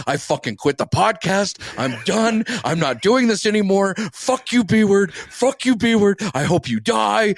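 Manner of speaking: high-pitched imitation of a tantrum; mock meltdown. Host imitating his co-host's meltdown over the logos, high mock outrage. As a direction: mimic your co-host losing it, pitched well up. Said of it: "you had a fucking meltdown".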